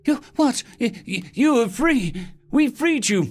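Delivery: high, frightened, stammering plea; panicked stammer. Acted. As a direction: stutter it out in terror. Cowering villain stammers in panic.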